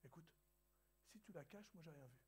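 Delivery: hushed, low-volume confidential aside; spoken softly. Retold or imitated. Waiter quietly tells the pastor to hide the cup, hushed. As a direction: keep it down like a waiter sharing a secret. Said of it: "il parlait pas très fort".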